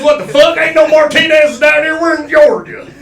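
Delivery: high, loud indignant shouting; hammed-up outraged Southerner. Southerner refusing the name Martinez, high mock-outraged shout. Acting out racist Southern town namer.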